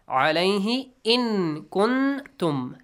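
"Alayhi in kuntum" word by word, drawn out; slow modelled recitation. Shown as a demonstration. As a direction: recite "in kuntum" slowly, lingering on the nasals. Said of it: "hidden sound".

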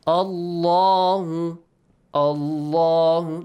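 "Allahu" very slow, with a long, heavy lam; heavy lam, drawn out. Shown as a demonstration. Teacher demonstrating the correct way to pronounce it, slow heavy lam. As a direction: stretch the middle of "Allahu", dark and full. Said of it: "that's why we pronounce it this way".

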